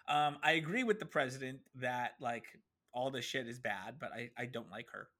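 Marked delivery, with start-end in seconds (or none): none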